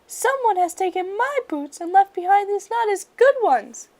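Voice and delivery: high, loud squeaky cub wail. A narrator's reading. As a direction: wail like an upset bear cub. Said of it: "she wailed".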